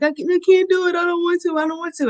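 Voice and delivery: half-acted whimper of a scared kid; crying child. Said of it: "And she was crying".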